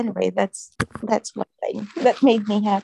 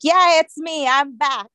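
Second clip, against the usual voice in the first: imitated stern patient, raised pitch; mock stern; 5 semitones higher, 5 dB louder than usual. Patient announces her return from hospital, mock stern. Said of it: "with a stern voice".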